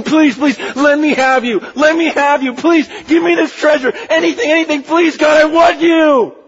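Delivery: begging God, rising to a shout; desperate begging. Retold or imitated. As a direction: cry out for God like you can't live without him. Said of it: "on our faces going".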